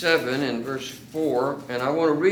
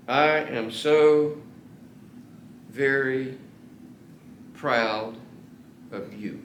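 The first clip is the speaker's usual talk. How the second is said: slow and deliberate; much slower than usual. Shown as a demonstration. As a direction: plant every word slowly in their minds. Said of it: "Hear the words".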